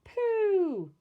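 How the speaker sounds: high, loud exclamation of disgust; disgusted cry. Acted. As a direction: recoil from the smell. Bill smells the dirty nappy.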